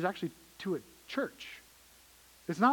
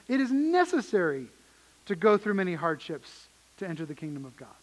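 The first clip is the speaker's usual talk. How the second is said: necessary pitched up and pressed hard; stress on necessary; 4 dB louder than usual. Shown as a demonstration. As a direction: lean into the word necessary. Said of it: "not just you might".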